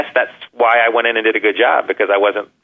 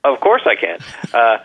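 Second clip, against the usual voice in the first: ordinary delivery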